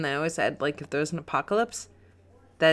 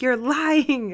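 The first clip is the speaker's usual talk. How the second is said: high, drawn-out accusation; mock accusing retort; 8 semitones higher, 3 dB louder than usual. Host quoting ex answering the ad, high mock accusation. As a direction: play up a teasing callout.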